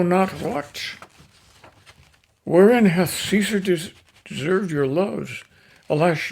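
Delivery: declaiming Shakespeare, swelling to loud peaks; dramatic recitation. Acted. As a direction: deliver Antony's speech to the crowd with rising passion. Antony addresses the crowd.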